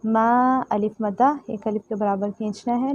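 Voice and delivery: ordinary talk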